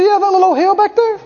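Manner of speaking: high, quick, offhand shrug; breezy dismissal. Retold or imitated. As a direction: brush it off like it was nothing. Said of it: "He said".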